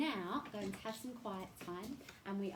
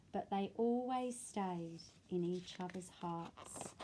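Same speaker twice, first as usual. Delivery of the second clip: put-on reading to children; 4 dB louder, slower than usual. A narrator's reading. Reads picture book aloud.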